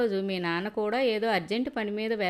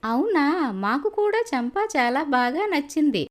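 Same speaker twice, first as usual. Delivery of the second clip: higher and louder, thrilled; pleased surprise; 6 semitones higher, 5 dB louder than usual. Acted. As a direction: exclaim with delight, pitched up. Groom's mother pleased surprise.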